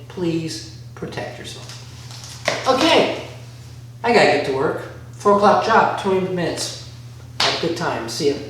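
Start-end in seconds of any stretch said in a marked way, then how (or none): 0.1-2.7 whispered slowly and softly for emphasis. Shown as a demonstration. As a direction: drop to a slow, earnest whisper.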